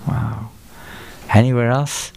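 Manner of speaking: ordinary talk, nothing marked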